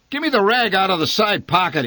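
father yelling an order during the bee hunt. Acted. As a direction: yell for the rag mid-chase. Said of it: "he yelled".

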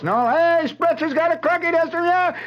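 high, loud mock taunt; excited high-pitched jeer; jeering mob. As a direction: holler it like a rowdy barracks mob.

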